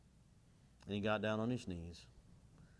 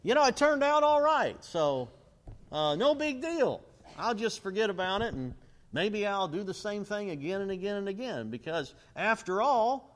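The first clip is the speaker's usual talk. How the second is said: breezy, much higher and louder, hey-no-worries tone; careless shrug-off; 8 semitones higher, 5 dB louder than usual. Retold or imitated. Careless believer shrugging off his blunder, breezy and loud. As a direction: toss off the blunder like it's nothing, loud and carefree. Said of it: "is for us to think".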